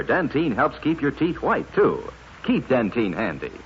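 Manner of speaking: ordinary delivery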